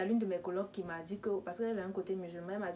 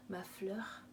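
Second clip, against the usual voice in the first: whispered endearment; 9 dB quieter than usual. Retold or imitated. The caller whispering "ma fleur" to charm her. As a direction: whisper "ma fleur" breathily, almost no voice. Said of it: "au début, c'était genre doux".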